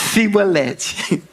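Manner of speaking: "Sibolete" slow with s instead of sh. Retold or imitated. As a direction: say "Sibolete" the wrong way. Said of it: "Aí eles falavam". ("Sibolete" com s, errado)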